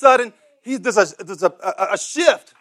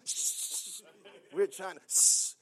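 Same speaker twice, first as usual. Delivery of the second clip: whispered shh, like a theatergoer; shushing whisper; 17 dB quieter, slower than usual. Retold or imitated. Churchgoers shush the blind man, whispering. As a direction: hush someone during the movie. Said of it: "They were shushing him".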